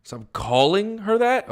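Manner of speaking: high, rising disbelief, slow; stunned repeat, voice climbing high; incredulous echo. Acted. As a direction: throw the order back as a baffled question.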